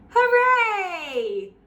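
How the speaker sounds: high, loud joyful cheer. Acted. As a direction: celebrate the win. Cheering the ogre's defeat.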